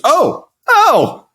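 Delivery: high loud mock yelp; excited gasp. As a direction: imitate her startled cry. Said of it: "she was".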